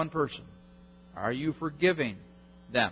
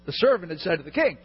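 normal talking voice

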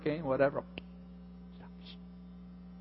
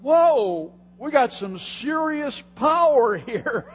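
amazed Peter; 8 semitones higher, 8 dB louder, slower than usual. Retold or imitated. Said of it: "he realizes".

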